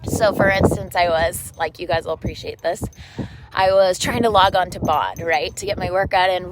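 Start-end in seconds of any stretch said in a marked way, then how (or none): none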